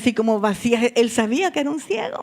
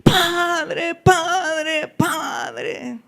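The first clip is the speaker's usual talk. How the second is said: drawn-out repeated call, starting high and fading; acted prayerful pleading; clamoring in prayer; 5 semitones higher, much slower than usual. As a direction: cry out to the Father, easing off on the last. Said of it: "necesito que salga el clamor".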